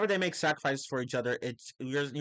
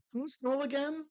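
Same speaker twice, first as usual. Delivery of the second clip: baffled; 12 semitones higher than usual. Real, not acted. Sudden confused question.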